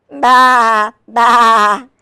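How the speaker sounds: loud, sustained baas; sheep bleating. A narrator's reading. Reader voices the sheep for children, loud bleating. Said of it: "pleaded the sheep".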